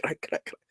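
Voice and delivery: voiceless choking sounds, done as a light mock; imitated choking gasps. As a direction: gasp out the dying character's choke. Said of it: "That's how she's looking".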